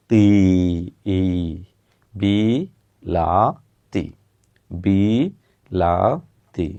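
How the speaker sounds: বিলাতি stretched very slowly, one syllable at a time; drawn-out syllables. Shown as a demonstration. Teacher slowly splits বিলাতি into syllables for class. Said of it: "মাঝেরটা রসিকার".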